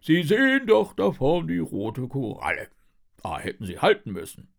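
loud, officious police sergeant; Wachtmeister Wackelzahn. A narrator's reading. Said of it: "Wachtmeister Wackelzahn wendet sich". (belehrender, lauter Polizistenton)

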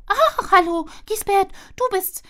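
high, bright squirrel greeting; chirpy squirrel. Acted. Squirrel greets her friend Giesbert, high and chirpy.

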